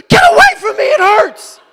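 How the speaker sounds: very high, loud mock yell breaking into a laugh; hammed-up pained shout. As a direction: shout her away, half laughing. Retold snapping at wife.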